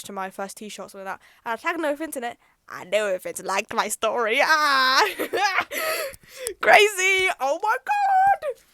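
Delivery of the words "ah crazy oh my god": high, loud squealing laughter; excited shriek. Real, not acted. Fan learning their idol liked their story, shrieking with joy.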